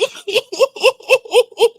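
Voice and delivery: high squealing laugh; high-pitched giggle. Real, not acted. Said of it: "let me put Jersey away".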